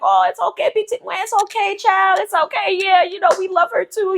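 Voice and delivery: high, soothing auntie voice; consoling. Retold or imitated. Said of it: "trying to console her, like".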